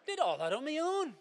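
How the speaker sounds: high, loud indignant cry; huffy outburst. Acted. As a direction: burst out offended. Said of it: "sits down in a huff".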